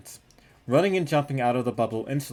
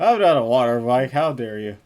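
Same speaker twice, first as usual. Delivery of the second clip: mock offended; 8 dB louder than usual.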